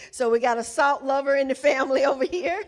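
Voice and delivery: high, fast, laughing through the words. Real, not acted. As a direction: tease them while cracking up. Teasing the salt-loving audience member.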